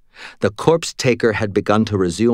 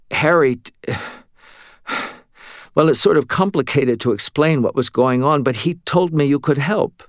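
put-on exhausted young woman; 5 semitones higher than usual. Exhausted Molly answering.

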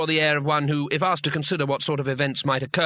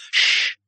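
sharp shush; 5 dB louder than usual. Acted. Wizard hushes another.

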